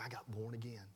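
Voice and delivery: hushed, voiceless whisper of the confession. Retold or imitated. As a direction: breathe it out as a secret whisper. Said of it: "You got what?".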